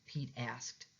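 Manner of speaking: ordinary delivery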